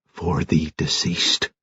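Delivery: slow, soft and hushed, put on for the occasion; special solemn voice. A narrator's reading. Village postman to Alleyne about the dead man, slow hushed solemn voice. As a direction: postman lowers his voice, slow and grave. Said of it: "he said in a special voice".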